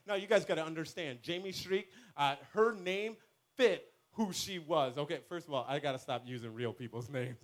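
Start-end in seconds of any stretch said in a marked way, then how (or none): none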